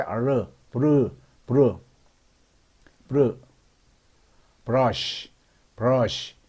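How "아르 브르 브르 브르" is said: 브르 with a lighter R, not an L; teacher's untapped R in 브르; light English R. Shown as a demonstration. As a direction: keep the R in 브르 light. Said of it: "R은 L보다 좀 가볍게 발음해야".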